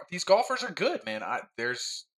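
ordinary delivery

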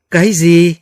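high, loud shocked exclamation; shocked cry. A narrator's reading. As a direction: blurt it out in disbelief. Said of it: "cùng một lúc thốt lên". (thốt lên kinh ngạc)

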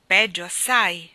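slow, loud, dismayed answer; heavy dismay, drawn out. A narrator's reading. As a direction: break bad news in dismay. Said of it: "con aria sgomenta".

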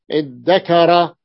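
ادكر said slowly with a long doubled d; ادكر as iddakara, slow. Shown as a demonstration. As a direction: hold the dd in ادكر. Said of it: "قلبت التاء دالا".

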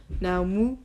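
lightly grumbled reply; a touch of grudging dismissal; grumbling. A narrator's reading. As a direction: grumble it mildly. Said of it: "bromde Jaro".

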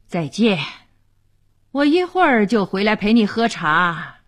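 husband relenting with impatience; impatient giving in. A narrator's reading. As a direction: give in just to end the begging. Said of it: "夏维尼最受不了别人的卑躬屈膝的". (不耐烦地让步)

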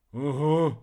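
loud, heaved sigh of assent. A narrator's reading. Dragon answering a toast to the second day, sighing. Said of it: "вздохнул дракон".

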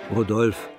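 ordinary talk, nothing marked

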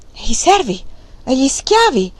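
stunned questions; astonished. A narrator's reading. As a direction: gasp the questions out. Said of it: "chiese Wang Lung a bocca aperta".

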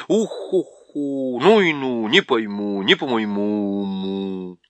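slow hooting rhyme under the breath; puzzled owl muttering in hoots; owl hooting to herself. A narrator's reading. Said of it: "ухая себе под нос".

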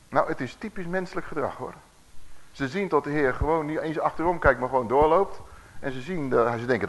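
ordinary delivery

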